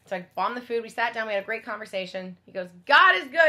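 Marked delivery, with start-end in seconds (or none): 2.8-3.5 loud, high, emphatic praise; grateful exclamation. Retold or imitated. Man thanking after a shared meal, loudly exclaiming. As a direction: proclaim it heartily.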